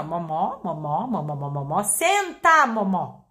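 blur everything except a sharp senta; what the dog hears: noise plus senta; mumbled filler. Shown as a demonstration. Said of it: "O resto da frase foi assim".

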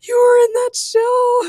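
gasp, then very high fan squeal; mock-squeal like an amazed anime nerd; high, loud fangirl disbelief; starstruck fangirl. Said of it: "I'm such an anime nerd".

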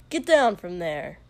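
loud barked order; commanding shout. Acted. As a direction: order him down, loud and hard. King orders prince down.

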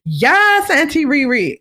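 mock high-pitched fangirl gushing; gushing fans. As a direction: mimic overexcited fans congratulating. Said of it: "The way people are like".

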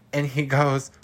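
ordinary delivery

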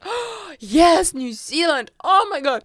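gasping, high-pitched thrilled reaction; imitated excitement. Agent hearing his favourite country named, imitated excitement. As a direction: gush in a high, delighted burst.

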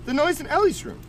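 put-on high little-girl voice; imitating three-year-old. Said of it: "My daughter's three".

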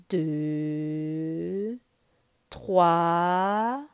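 low, slow tiger voice; menacing tiger counting. Acted. Shere Khan counting for Mowgli to hide, low and slow. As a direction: count like a predator closing in.

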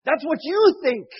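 fervent, loud sermon delivery; preaching. Acted. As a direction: preach it out with full force. Preaching to the audience.

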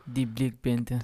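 exaggerated film African accent, low; mock African accent. Co-host echoing the film line, mock African accent. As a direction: echo a bad movie African accent. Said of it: "Worst accents ever in a movie".

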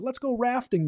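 high, playful mock cheer; excited Slater. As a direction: toss it off like an eager jock. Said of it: "Slater's like".

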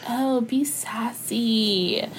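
mockingly imitated brand speak; mock brand rep. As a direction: stretch it out, mimicking a corporate request.

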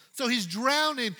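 ordinary talk

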